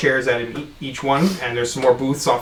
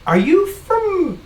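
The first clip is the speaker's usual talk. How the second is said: excited bartender; slower than usual. Acted. Bartender eagerly questioning the stranger.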